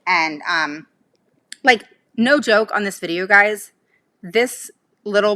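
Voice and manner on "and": ordinary talk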